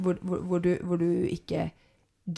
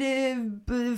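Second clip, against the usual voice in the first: ordinary talk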